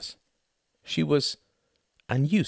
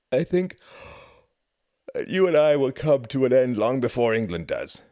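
higher voice, talking through a yawn; 7 semitones higher, 5 dB louder than usual. Acted. Quentin answers gloomy talk of the end, yawning. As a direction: let a yawn swell through the words. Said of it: "yawning again".

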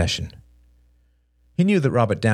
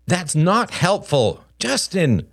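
high, slow, strained warning; exasperated parent's slow correction; stern reproof; 10 semitones higher, slower than usual. Acted. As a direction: rebuke the boy word by word. Father reproves his son.

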